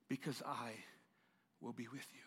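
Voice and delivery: God's soft whisper; hushed reassurance. Acted. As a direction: whisper the promise gently. God answers softly.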